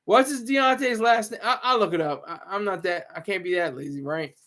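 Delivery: ordinary talk, nothing marked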